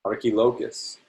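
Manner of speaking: usual speaking voice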